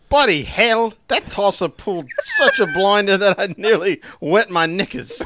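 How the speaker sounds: loud, high mock Cockney; hammed-up London geezer voice; Cockney accent.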